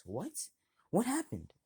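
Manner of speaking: high, hammed-up innocent gasp; fake surprise. Bully faking innocence to his mother, hammed-up high surprise. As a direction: overplay the shock to cover a lie. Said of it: "acting skills one hundred".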